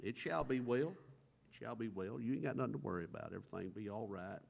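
calm him with a gentle promise; reassuring. Retold or imitated. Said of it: "that's what she tells her husband".

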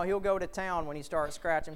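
ordinary talk

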